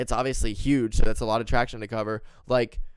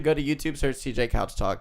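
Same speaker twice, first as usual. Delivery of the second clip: usual speaking voice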